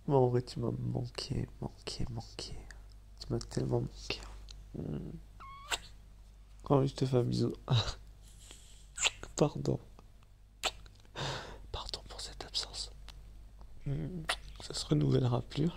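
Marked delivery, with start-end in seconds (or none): 1.6-4.2 soft fading whisper; whispered longing. Real, not acted. Répète tu m'as manqué tendrement. 11.7-12.9 barely voiced whisper; whispered apology. Real, not acted. Demande pardon tout bas.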